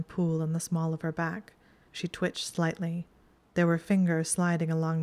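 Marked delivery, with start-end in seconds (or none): none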